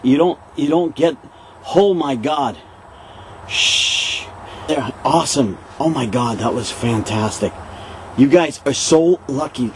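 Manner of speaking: ordinary talk, nothing marked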